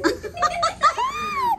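high squealing burst of laughter; shrieking laugh. Real, not acted. Mother watching her baby fight a balloon, shrieking with laughter. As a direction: let out a delighted squeal of a laugh.

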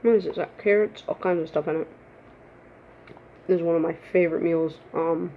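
speaking between bites; slightly muffled mealtime speech; talking while eating noodles. Real, not acted. Seen 1: Forkful of noodles raised near her mouth while talking; mouth fullness unclear.

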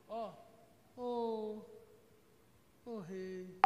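drawn-out mock-humble address to the king; a hint of a sly courtier; fawning opportunist. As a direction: grovel like a false flatterer. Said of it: "A primeira ação de um oportunista".

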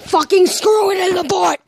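high, loud shout; mock outburst. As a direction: blow up in joking frustration. Mock outburst, then says kidding.

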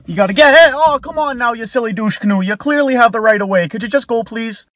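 high, loud exasperated scolding; mock angry yell. Canadian yelling at a driver to just go, loud and high.

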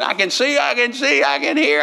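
high-pitched exclamations of the healed; imitated excited cries. People running at a crusade after healing, imitated excited cries. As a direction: cry out as someone imitating sudden amazement.

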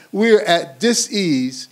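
"dis-ease" split slowly, both halves weighted; slow down and pull apart "dis-ease"; drawn-out wordplay. Real, not acted.

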